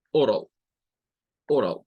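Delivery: oral with or and all joined; English oral. Shown as a demonstration. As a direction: say oral as one clean word. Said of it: "Sería la pronunciación". (oral pronunciado en inglés, or-al)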